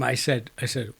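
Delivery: usual speaking voice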